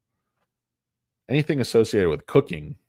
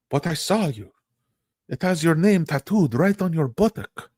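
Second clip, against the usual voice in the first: higher, hammed-up soap-opera wife accusing; mock telenovela accusation; 8 semitones higher than usual. Wife confronting in acted telenovela.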